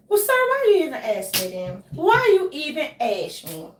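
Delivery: mock-indignant challenge to a boss; exaggerated exasperation. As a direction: play up the exasperated comeback. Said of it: "I ain't say it like that".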